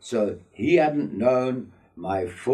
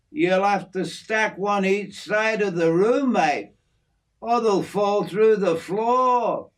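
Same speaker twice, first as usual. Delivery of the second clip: a lightly voiced friendly Aussie bloke, slightly raised; easygoing outback mate showing off his aviary; hint of a broad Australian working man; Aussie bloke Jim; 12 semitones higher, 4 dB louder than usual. Jim jokes about the cases.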